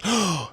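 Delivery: sharp, high mock gasp; shocked gasp.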